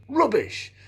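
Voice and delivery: high dismissive exclamation. Shown as a demonstration. Repeats the dismissive word.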